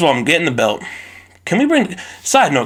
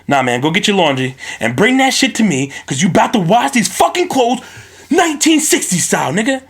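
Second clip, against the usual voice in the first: enraged parent; 7 semitones higher than usual. Retold or imitated. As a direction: blow up at the kid at last. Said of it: "I walk in there".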